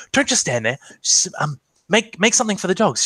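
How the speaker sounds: voiced character giving a fussed instruction; brisk scolding sister. Game master voices a scolding sister.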